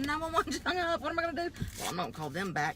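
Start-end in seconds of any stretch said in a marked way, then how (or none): 0.0-1.5 panicked. Retold or imitated. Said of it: "I'm going".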